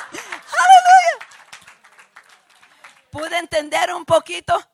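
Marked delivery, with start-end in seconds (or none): 0.1-1.3 very high, loud, drawn-out hallelujah shout; exultant praise cry. Real, not acted. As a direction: let out a long shriek of praise. Cries praise to the congregation.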